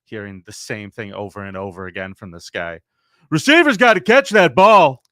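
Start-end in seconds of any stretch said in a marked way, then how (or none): 3.3-5.0 loud, high booming Madden call; big play-by-play bark of an old coach; John Madden impression. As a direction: belt it like a football commentator. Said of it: "Thanks, John".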